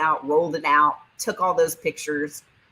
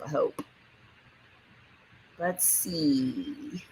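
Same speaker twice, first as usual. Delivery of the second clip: ordinary delivery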